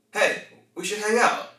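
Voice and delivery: "Hey, we should hang out" pitched high, rising on "hang out"; question intonation. Shown as a demonstration. Coach showing an invitation to avoid, high and questioning. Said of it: "one is a question".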